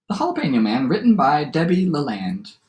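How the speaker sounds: lively storyteller read-aloud with swooping pitch; story narration. A narrator's reading. Teacher reads a favorite adapted fairy tale aloud, lively storytelling. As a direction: read it to children as a tall tale.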